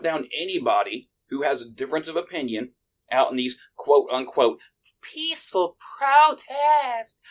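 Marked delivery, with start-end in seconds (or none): 3.3-7.2 sarcastic. Acted. Sarcastic lead-in to mocking protesters.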